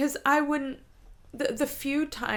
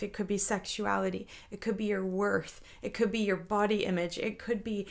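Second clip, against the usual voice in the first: usual speaking voice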